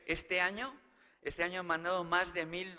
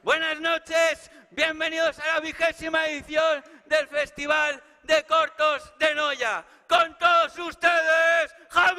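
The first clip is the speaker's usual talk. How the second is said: slow, higher, louder shouted gala intro; presenter opening the festival, big stretched shout; grand announcer shout; 12 semitones higher, 14 dB louder, slower than usual. Acted. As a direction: bellow the welcome like a showman. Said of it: "Yo me voy a volver a presentar".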